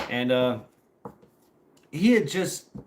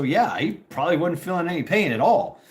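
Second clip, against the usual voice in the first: normal talking voice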